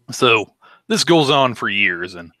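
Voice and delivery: ordinary delivery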